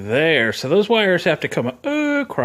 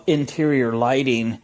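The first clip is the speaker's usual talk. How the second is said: ordinary talk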